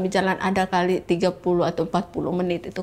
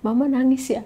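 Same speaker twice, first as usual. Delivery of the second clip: put-on higher child's question; retold boy's higher-pitched question; imitating her son; 6 semitones higher than usual. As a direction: lightly voice a concerned little boy, pitched up. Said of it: "anak saya yang laki-laki".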